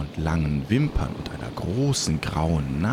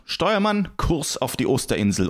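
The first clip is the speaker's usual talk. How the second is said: pitched-up captain's call on the name; calling out orders; 7 semitones higher than usual. Acted. Captain to his helmsman, setting course, calling out.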